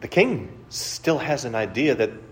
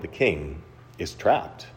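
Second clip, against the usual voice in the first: usual speaking voice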